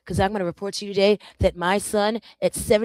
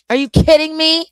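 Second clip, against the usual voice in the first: high, loud are you kidding me; exasperated outburst; 9 semitones higher, 12 dB louder than usual. Real, not acted. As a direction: blurt it out, fed up. Frustrated outburst at mishap.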